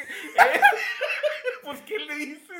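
loud, high, laughing scold; mock loud scolding. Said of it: "le dije".

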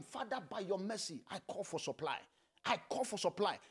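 low, soft plea to God; subdued intercession; hushed prayer. Real, not acted. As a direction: drop into a quiet, earnest prayer. Leads a prayer.

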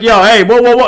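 high, loud string of calming interjections; urgent pacifying. Acted. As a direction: act talking down a raging attacker. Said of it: "try to, like, pacify you down".

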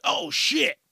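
sudden jump up in pitch, caught off guard; high yelp of alarm; startled oh shit. Retold or imitated. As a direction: react like a bike just shot past you. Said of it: "You're like".

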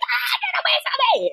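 high shrieking get away; child's scream. Retold or imitated. Parent retelling a child shrieking at mommy, high scream. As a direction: shriek it like a toddler pushing mom away. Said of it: "they're having a meltdown".

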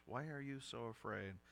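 soft, gentle question; hushed, tender reproof from Jesus; gently. Shown as a demonstration. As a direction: drop the volume and ask it tenderly. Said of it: "if he sort of gently says".

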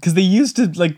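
normal talking voice

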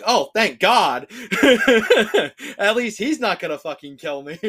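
loud laughing relief. Retold or imitated. Woman on a first date laughing in relief, loud.

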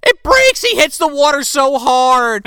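high, loud burst of excitement; excited outburst. Real, not acted. Telling co-hosts the rope snaps, excited outburst. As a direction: blurt it out loud and high, thrilled.

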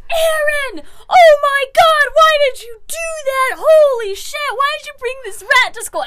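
high, loud mock-hysterical shrieking; hammed-up panicked yelling; freaking out. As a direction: imitate a whole class in uproar. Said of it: "Everyone's freaking out".

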